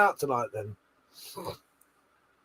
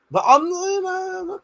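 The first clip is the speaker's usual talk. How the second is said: mock modesty.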